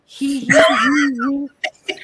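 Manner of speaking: a tiny mock giggle, a slow he-he-he; imitating mom's little laugh. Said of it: "But I can't imitate her laugh".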